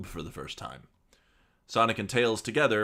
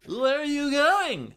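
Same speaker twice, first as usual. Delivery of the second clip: high Tails voice; 7 dB louder, slower than usual. Acted. Reading Tails's line in a voice.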